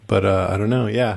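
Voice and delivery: ordinary delivery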